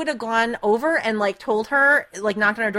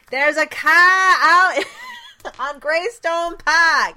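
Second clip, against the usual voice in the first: loud, drawn-out, higher street holler; imitated yell; 7 semitones higher, 6 dB louder, much slower than usual. Street sweepers yelling our address at the neighbours, drawn-out mock yell. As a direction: holler it slowly down the whole street. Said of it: "yelling our address".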